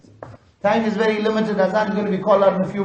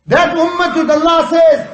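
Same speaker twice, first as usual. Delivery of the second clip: preacher's shout; 6 semitones higher, 4 dB louder than usual. Real, not acted. Rousing call to the congregation.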